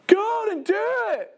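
high-pitched calling out; egging on. Retold or imitated. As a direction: imitate a friend hollering up to you. Friend egging him on from below.

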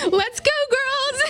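high, slow sing-song call to get up; mock cheerful wake-up call from the youth leader; chirpy wake-up call. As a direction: call the girls out of bed cheerfully. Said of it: "be like".

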